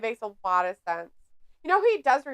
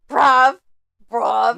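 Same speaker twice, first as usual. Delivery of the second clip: British bruv; 13 dB louder than usual. Pretend. Mock British slang shouting.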